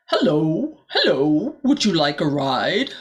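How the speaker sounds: lightly voiced frog, a hint of a croak. A narrator's reading. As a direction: greet her as a friendly frog. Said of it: "leapt to Rosella's side and croaked".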